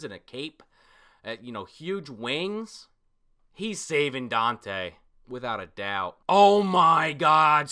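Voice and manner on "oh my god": loud, slow, stunned; real shock. Real, not acted. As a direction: drag it out in disbelief. Reacting to shocking chapter.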